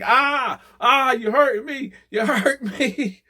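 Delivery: high, slow, loud mock wail; hammed-up old man crying out in pain; old man whines at the guard, high and slow; whining old man.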